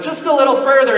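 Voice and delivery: retold urging. Urging the friend on the cliff.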